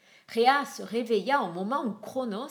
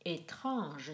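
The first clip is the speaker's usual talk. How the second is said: quiet wonder; 4 semitones lower, 7 dB quieter than usual. A narrator's reading. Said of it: "se dit Hermès".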